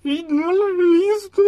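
put-on crying for his girlfriend while being fed pizza; drunk sobbing. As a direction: blubber it, voice climbing into a wail. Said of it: "Y estaba llorando y llorando".

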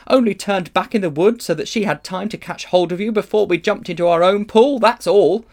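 high, loud, mounting sarcastic rant; sarcastic tirade. A narrator's reading. Polly lists what Diggory did wrong, scathingly sarcastic. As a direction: pile up the accusations, rising to an indignant finish. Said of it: "said Polly sarcastically".

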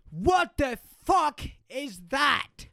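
loud, slow, high furious chef shout; Gordon Ramsay. Acted. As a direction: bellow each word like an outraged chef. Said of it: "best Gordon Ramsay impression".

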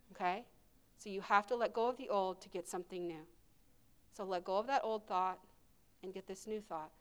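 soft, intimate altar call; hushed ministry voice. Acted. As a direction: speak softly, as if ministering at the altar. Quiet altar ministry, hands on hearts.

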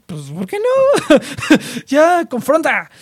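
high, slow mocking taunt with a laugh; mock-innocent teasing, slow and high; teasing goad. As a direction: needle him, drawn out and playful. Voicing himself goading the friend.

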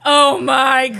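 big gasp, then loud thrilled exclamation; mock scandalized gasp. [name] watching funeral drama in the corner, mock scandalized delight.

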